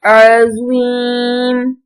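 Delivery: slow, measured recitation with long-held vowels; Quran spelling drill. Shown as a demonstration. Teacher spells out Arabic words for pupils, drawn-out recitation. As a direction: chant each syllable out for pupils.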